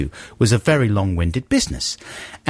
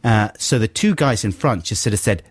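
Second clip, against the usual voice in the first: usual speaking voice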